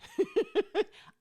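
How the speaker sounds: big burst of high laughter; a loud delighted cackle. Real, not acted. Laughing at own send-off.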